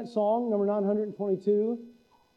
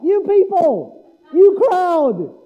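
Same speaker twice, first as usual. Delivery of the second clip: mock outraged; 8 semitones higher, 9 dB louder, much slower than usual. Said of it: "Who says that?".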